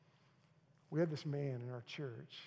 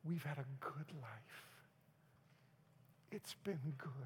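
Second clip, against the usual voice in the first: soft breathy whisper; quoting a dying wife, whispered; dying wife's whisper; 7 dB quieter than usual. Retold or imitated. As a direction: whisper it like her last words. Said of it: "she says".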